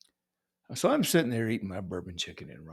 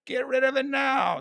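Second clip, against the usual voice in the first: mock shout. Mock announcement peaks.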